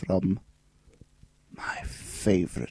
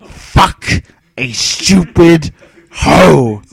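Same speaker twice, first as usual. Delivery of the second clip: overly enthusiastic; 3 semitones higher, 11 dB louder, much slower than usual. Acted. Actor performing rap lyrics for the show, overly enthusiastic. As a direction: belt each word out with too much gusto. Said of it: "you sounded a bit too enthusiastic".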